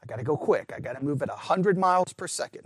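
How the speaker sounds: ordinary talk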